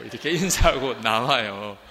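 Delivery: telling it through laughter; amused laughing narration. Real, not acted. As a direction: chuckle through the words. Laughing while telling story.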